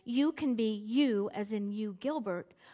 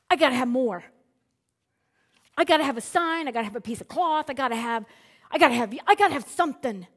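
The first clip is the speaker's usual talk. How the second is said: louder, insistent refusal; skeptical demand; 8 dB louder, faster than usual. Retold or imitated. Skeptic demands a sign from God, louder and insistent. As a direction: loudly insist God give more. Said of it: "I would have said".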